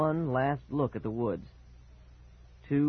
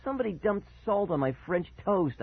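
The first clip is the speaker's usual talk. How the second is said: higher-pitched outrage; angry exclamation; 8 semitones higher than usual. A narrator's reading. Boy finds salt on his toast, exclaiming angrily. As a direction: accuse with raised pitch. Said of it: "I exclaimed angrily".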